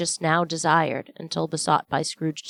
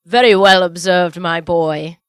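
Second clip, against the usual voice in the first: a touch louder, proud father's praise; cried out; 11 dB louder than usual. A narrator's reading. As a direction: call it out warmly to the boy. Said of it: "cried Bob".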